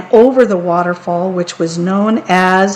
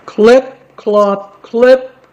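hoofbeat sounds; 4 semitones higher, 5 dB louder, much slower than usual. A narrator's reading. Hooves on the bridge.

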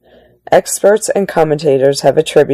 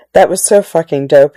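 normal talking voice

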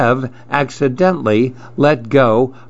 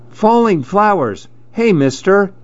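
put-on high child voice hailing a stranger; high-pitched storybook boy; boy calling out; 9 semitones higher than usual. A narrator's reading. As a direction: call out like an excited kid. Boy calls out to passer-by.

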